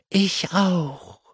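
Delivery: high, bright ladybird call. Acted. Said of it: "rief der Marienkäfer".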